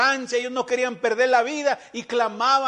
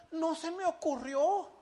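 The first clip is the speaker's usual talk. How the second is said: softer, timid "No se me ocurrió"; sheepish excuse; 5 semitones higher, 10 dB quieter than usual. Retold or imitated. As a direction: play the lazy brother shrugging it off. Brother excuses himself, sheepishly. (excusa tímida en voz baja)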